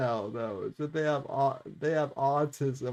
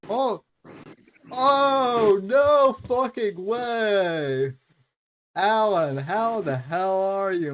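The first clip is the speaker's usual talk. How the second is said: excited shout; 4 semitones higher, 6 dB louder, slower than usual. Real, not acted. Excited greeting of guest.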